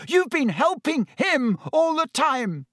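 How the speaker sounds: very high, strained accusation; high, heated yell without full volume. A narrator's reading. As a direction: cry out in outrage, pitched right up. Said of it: "Harry yelled".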